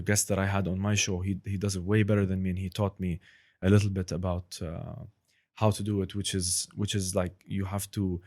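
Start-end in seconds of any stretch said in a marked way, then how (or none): none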